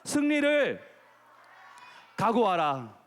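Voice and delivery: rallying call-and-response lead; leading a repeat-after-me. Shown as a demonstration. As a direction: call it out for the children to echo. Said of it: "처음에 따라합니다".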